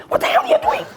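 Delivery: very high, strained outcry; alarmed yell. Retold or imitated. Shouted at the man with the sword, very high yell. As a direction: yell it at the top of your range. Shouted during the retold quarrel.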